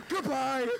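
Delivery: high mock dismissal; hammed-up, high-pitched send-off; fed-up teacher. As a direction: imitate a teacher ordering a student out, pitch way up. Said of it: "like, pretty fed up".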